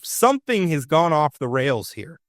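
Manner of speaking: usual speaking voice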